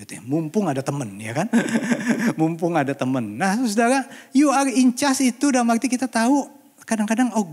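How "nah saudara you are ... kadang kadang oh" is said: high, loud exhortation to the congregation; impassioned, raised preacher's voice; forceful preaching. Real, not acted. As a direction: drive each phrase home at full voice.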